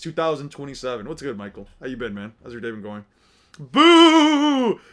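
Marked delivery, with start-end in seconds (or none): 3.6-4.8 loud, high jeering boo; hammed-up jeer. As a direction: boo him like a stadium crowd. Booing friend.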